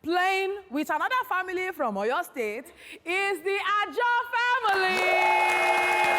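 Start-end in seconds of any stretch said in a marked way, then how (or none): 3.1-6.1 slow, drawn-out name call; game show announcement. Acted. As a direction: stretch the family name out. Host announces family.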